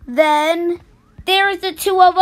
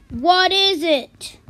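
usual speaking voice

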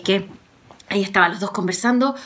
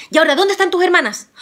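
higher, louder and fast question; eager hero; 9 semitones higher, 5 dB louder, much faster than usual. Acted. Juan asks Rosa about her sisters, eager and quick. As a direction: press on with the rescue.

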